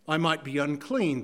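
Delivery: ordinary talk, nothing marked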